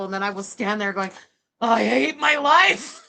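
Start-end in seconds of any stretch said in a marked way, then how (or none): 1.6-3.0 slow, loud mock-crying whine; hammed-up self-pitying sob; baby bitch mode. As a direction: wail it like a tantruming baby. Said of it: "That's me in baby bitch mode".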